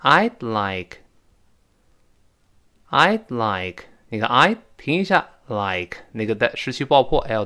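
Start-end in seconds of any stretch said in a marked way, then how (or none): 0.0-3.8 I'd like with a short hold after I'd and an unreleased d; teacher reads I'd like for the class, pausing after I'd; model reading. Shown as a demonstration. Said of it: "我们读一下这几个字儿".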